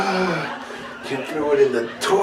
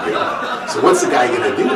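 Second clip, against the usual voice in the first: usual speaking voice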